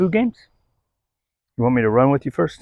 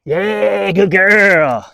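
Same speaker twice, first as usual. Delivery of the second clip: excited praise; 6 semitones higher, 5 dB louder, much slower than usual. Real, not acted. Praising dog after play.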